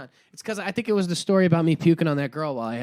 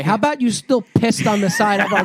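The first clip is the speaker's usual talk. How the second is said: louder, laughing taunt; laughing jab; 3 semitones higher, 7 dB louder than usual. Real, not acted. Friend taunts him about his daughter, laughing loudly. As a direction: throw it back at him through laughter.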